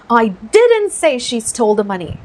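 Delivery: slow demo line with the emphasis on "didn't"; stress on DIDN'T. Shown as a demonstration. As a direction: hit the word "didn't" hard. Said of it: "ये didn't पे focus लगाते हैं".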